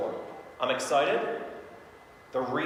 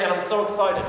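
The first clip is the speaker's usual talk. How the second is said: higher, louder, keen emphasis; excited; 5 semitones higher, 6 dB louder than usual. Shown as a demonstration. Coach shows the line that shifts client's attention, excited. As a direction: throw the attention onto being excited. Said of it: "I throw the attention over here".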